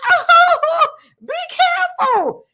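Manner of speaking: very high, loud prissy squeal; mock the passenger's shrill prim warning; high-pitched mocking imitation of a fussy woman. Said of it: "She goes".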